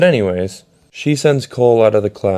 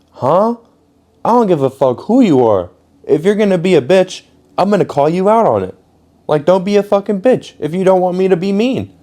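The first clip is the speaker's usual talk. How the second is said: loud, much higher furious rant; 10 semitones higher, 4 dB louder than usual. Retold or imitated. Yelling back at the teacher in detention, furious. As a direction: let the rage spill out at her.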